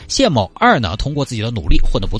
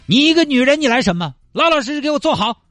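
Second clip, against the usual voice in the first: put-on drunk angry shout. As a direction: rant like a stubborn drunk. Drunk man yelling at girlfriend. (醉醺醺地冲女朋友发火)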